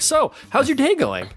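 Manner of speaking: chatty dentist voice. Retold or imitated. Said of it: "and they're like".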